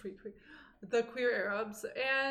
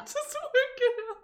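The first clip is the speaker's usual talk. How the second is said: high giggled echo; repeating a friend's words, giggling helplessly; cracking up; 12 semitones higher, 5 dB louder than usual. Real, not acted. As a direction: squeeze the words out through laughter. Echoes friend while cracking up.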